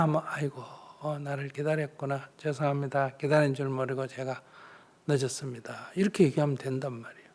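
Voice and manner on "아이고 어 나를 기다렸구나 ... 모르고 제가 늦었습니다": low, very soft soothing reply; acted conciliatory apology. Wife apologising to waiting husband, tender and soft. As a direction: give way softly and apologise.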